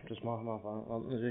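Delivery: ordinary delivery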